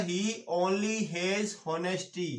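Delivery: very slow, word by word; slow dictation. Shown as a demonstration. Teacher dictating to class, very slow and deliberate. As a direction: spell it out slowly for students copying.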